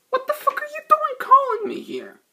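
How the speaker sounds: high indignant squawk at the ex-wife; mock irate phone voice. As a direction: imitate an annoyed ex-husband picking up. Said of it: "and he goes just".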